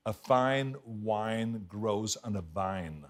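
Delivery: slow, soft drill, clean F in "fine", W in "wine", V in "vine"; careful diction drill. Shown as a demonstration. Pupil repeats speech coach's line, "wine" and "vine" carefully distinct. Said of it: "he had me say lines like".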